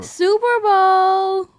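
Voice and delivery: loud, high and drawn out slowly; teasing a friend's festival name with slow, loud awe; mock awe. Real, not acted. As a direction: stretch it like a big announcement.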